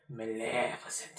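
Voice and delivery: low, slow Scottish bad guy; heavy Scots accent. Quoting the film's bad guy, heavy Scots accent. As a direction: drag it out softly in thick Scots. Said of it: "with his heavy Scots accent".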